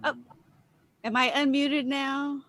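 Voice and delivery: ordinary talk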